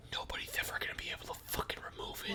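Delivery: fast, soft, intense whisper; fierce whisper. Acted. The kid whispering a fierce promise to the prisoner.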